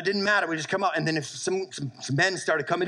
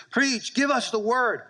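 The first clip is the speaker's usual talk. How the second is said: pleading cry; 6 semitones higher, slower than usual. Retold or imitated. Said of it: "they're crying out".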